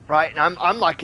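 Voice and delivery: ordinary delivery, nothing marked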